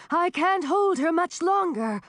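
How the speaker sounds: high, strained-sounding urgent shout. A narrator's reading. Dragoness losing her hold to helpers, shouting. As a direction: warn them urgently at a shout. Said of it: "shouted Song of the Glacier".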